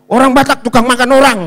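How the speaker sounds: cepat, keras, nada tinggi; seruan menuduh. Retold or imitated. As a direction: tirukan tuduhan lantang si kakak ipar. Meniru keberatan keras kakak ipar. (menuduh dengan lantang)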